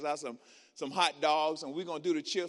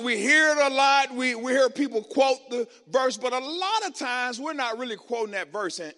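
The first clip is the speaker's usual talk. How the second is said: loud, high sermon delivery; preaching; 4 semitones higher, 7 dB louder than usual. Acted. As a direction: proclaim the message to the crowd.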